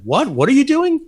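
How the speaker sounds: high, incredulous retold question; baffled owner, voiced high; astonished reaction. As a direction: quote him amazed, pitch leaping up. Retells magazine owner's astonished reaction.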